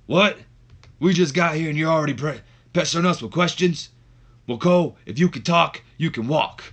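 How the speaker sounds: loud, high-pitched wrestler impression; Stone Cold. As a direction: cut off the interviewer like an irate wrestler. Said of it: "as Stone Cold and the".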